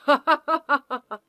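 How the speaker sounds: smug laugh. Acted. Smug laugh after taunt.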